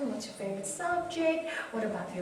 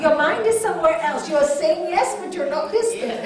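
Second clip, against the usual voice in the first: mock scolding child; 5 semitones higher, 11 dB louder than usual. Children scolding their mom.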